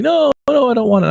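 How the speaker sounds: put-on high, pouty little-kid refusal; imitating a toddler. Dad imitates his two-year-old son turning down girl toys, in a high toddler voice.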